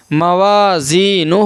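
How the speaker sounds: slow "موازینہ", long aa, ee and final oo; drawn-out recitation. Shown as a demonstration. Quran teacher modelling the word, "موازینہ" slowly stretched. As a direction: hold each long vowel in "موازینہ". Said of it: "ایک الف کے برابر کھینچ کر پڑھیں گے".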